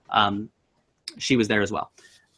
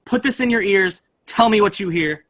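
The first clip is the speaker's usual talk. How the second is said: urgent; 8 semitones higher than usual. Retold or imitated. Running to sister with recorder.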